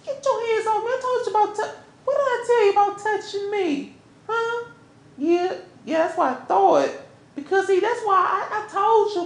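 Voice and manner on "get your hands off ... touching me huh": high-pitched, affronted scolding; acted outrage, high but not shouted; indignant warning. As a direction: warn off an unwanted touch, pitched up. Acted quarrel, warning partner off.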